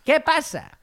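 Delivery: high, punchy rhetorical "¿qué pasa?"; spring the question up high; dramatic question. Real, not acted. Telling the follower story, dramatically high.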